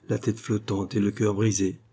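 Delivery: ordinary delivery, nothing marked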